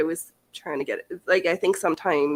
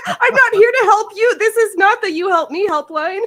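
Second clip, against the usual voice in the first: much higher and louder sneering parody of a support guy; shrill loud mocking impression of a dismissive agent; mock rude agent; 13 semitones higher, 9 dB louder than usual. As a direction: squeal it like a snotty helpline worker. Mocking an unhelpful male agent.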